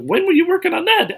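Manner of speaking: hammed-up family surprise; mock surprised family. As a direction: ask it like they can't believe he did it. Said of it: "They're all like".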